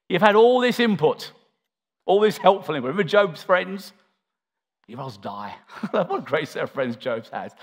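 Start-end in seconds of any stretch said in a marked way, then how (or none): 4.9-5.6 low, soft, deadpan doom; gloomy friend. Retold or imitated. Job's friend pronouncing doom, low and deadpan. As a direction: intone it like a grim comforter.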